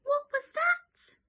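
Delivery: high, slow wondering question; surprised. Acted. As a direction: ask it wide-eyed and amazed. Excited question after animal sound.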